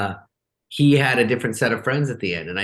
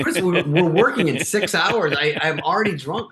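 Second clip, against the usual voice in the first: high, louder whiny protest; pleading protest; 8 semitones higher, 3 dB louder than usual. Retold or imitated. Telling Chris they work in six hours, mock whiny high protest. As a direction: beg off one more drink. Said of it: "I go".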